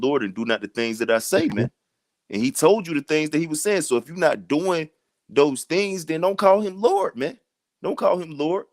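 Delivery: high, rising preacher's exhortation; impassioned street-preacher pitch climbing; fired-up preaching. Real, not acted.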